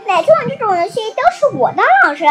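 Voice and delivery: loud, fast indignant protest. Acted. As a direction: insist it's your turn. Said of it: "小熊妹妹抗议道". (气呼呼地大声抗议)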